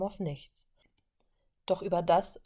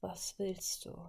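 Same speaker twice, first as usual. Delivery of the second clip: much softer, toneless reply; monotone; 10 dB quieter, slower than usual. Acted. Prisoner answering Wanda from her cell, soft monotone. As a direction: answer with no feeling at all. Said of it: "fragte sie mit monotoner Stimme".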